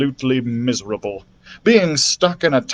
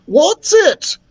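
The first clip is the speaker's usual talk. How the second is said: high, louder exclamation; startled question; 5 dB louder than usual. A narrator's reading. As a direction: blurt it out puzzled. Two characters ask at once.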